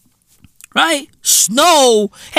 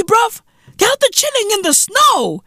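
usual speaking voice

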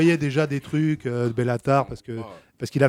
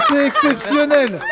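high, drawn-out amazement; astonished delight; 11 semitones higher, 4 dB louder, slower than usual. Real, not acted. Host reacting to the Bellatar mix-up, astonished. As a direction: stretch it out, pitched way up in disbelief.

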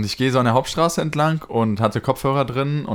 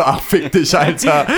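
higher, mildly exasperated retort; mock fed-up dismissal; 4 semitones higher, 5 dB louder, slower than usual. Narrator dismisses begging man, imitated fed-up retort. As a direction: brush off the beggar, half-laughing. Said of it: "Ich so".

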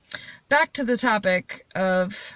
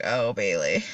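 low, softer mock sigh of a name; mock reaction; 13 semitones lower, 4 dB quieter than usual. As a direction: drop the voice low, as if reacting to a recording later. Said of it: "listen to this shit later and be like".

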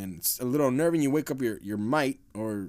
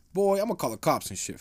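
ordinary delivery

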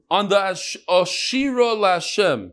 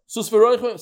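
the leader's call, a touch higher; 4 semitones higher than usual. Shown as a demonstration. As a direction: start the line for them. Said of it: "They say".

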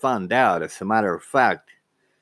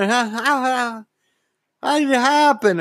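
happy mom. Retold or imitated. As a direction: gush like a thrilled mother, pitched way up. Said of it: "she gets all happy".

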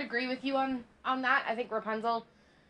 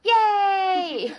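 excited cheer; 9 semitones higher, 8 dB louder than usual. Real, not acted. Cheering the co-host's return.

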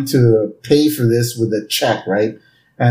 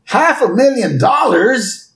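high, slow, stunned outcry; mock-aghast cartoon exclamation; shocked disbelief; 10 semitones higher than usual. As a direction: echo the price, stretched out and aghast. Homer shocked by the price.

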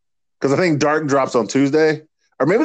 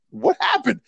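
high mock surprise; 4 dB quieter than usual. Retold Sue surprised.

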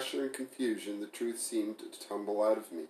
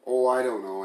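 louder, sarcastic brush-off; 4 semitones higher, 9 dB louder than usual. Acted. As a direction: toss it off with biting sarcasm. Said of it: "Emily said sarcastically".

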